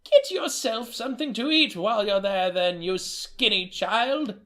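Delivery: high quavering grandmother voice; a doting old nana greeting her boy; delighted old woman greeting her grandson, high-pitched. Acted. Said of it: "Emma Joe said".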